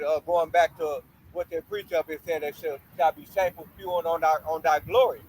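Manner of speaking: ordinary talk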